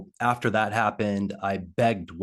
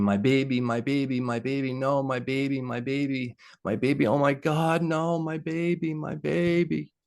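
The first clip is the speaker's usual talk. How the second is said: high, rising mother's wail, growing higher each time; retold anguished screaming; 6 semitones higher than usual. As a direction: cry out for the dead child, pitch climbing. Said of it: "uh, screaming".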